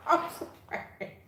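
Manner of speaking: high, slow, through laughter; high, laughing mock sorry; laughing apology. Real, not acted. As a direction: giggle the apology out.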